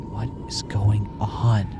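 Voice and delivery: slow, breathless whisper; frightened whisper. Acted. Trapped girl whispers to herself, frightened. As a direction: whisper it, too scared to speak.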